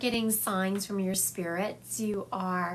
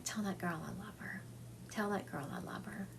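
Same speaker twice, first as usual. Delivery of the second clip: softer, tender message of love; sweet and tender; 11 dB quieter than usual. Retold or imitated. Husband sending love to his wife, soft and tender. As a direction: pass on his love gently, much softer. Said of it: "something about the way he said it was just so sweet".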